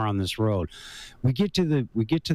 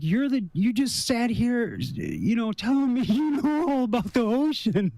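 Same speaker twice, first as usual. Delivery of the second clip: high and laughing through the words; high-pitched incredulous laugh-talk; laughing disbelief; 10 semitones higher than usual. Retold or imitated. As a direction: needle him while cracking up. Mocking the guy mid-panic.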